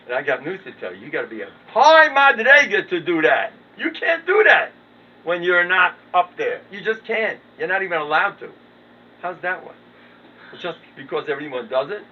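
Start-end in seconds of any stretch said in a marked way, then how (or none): 1.0-8.5 high, loud preachy rant; mock rant. Lecturing the audience about false humility, loud high rant. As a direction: drive it home like a preacher scolding the crowd.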